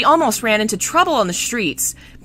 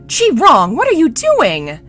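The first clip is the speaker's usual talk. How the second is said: loud, high-pitched acted cry; shouted, shocked reprimand; alarmed scolding; 9 semitones higher, 7 dB louder than usual. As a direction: call out sharply to stop him. Shi Lian stops violent cousin.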